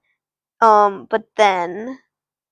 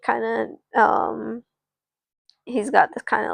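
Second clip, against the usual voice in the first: plain reading voice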